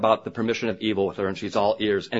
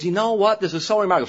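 lightly imitated excitement, pitched up; excited; 9 semitones higher, much faster than usual. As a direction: retell her thrilled phone call with a hint of her excitement. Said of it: "She's all excited".

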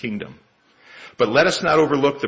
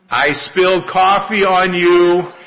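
slow, loud, higher and overly deliberate; slowed-down patient speech; 7 semitones higher, 8 dB louder, slower than usual. Acted. Young man slowing down for the confused old man, loud and deliberate. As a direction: spell it out slowly for someone hard of hearing. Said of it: "patiently slows down his speech".

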